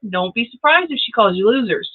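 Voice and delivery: ordinary talk, nothing marked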